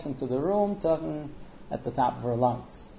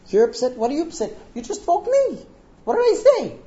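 high-pitched, loud exasperated protest; indignant disbelief; 12 semitones higher, 5 dB louder than usual. Retold or imitated. Woken husband to his upset wife, indignant disbelief. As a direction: protest in escalating bewilderment. Husband baffled in acted quarrel.

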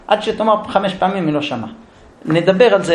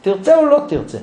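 ordinary talk

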